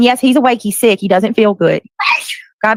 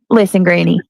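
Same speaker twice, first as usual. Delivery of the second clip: usual speaking voice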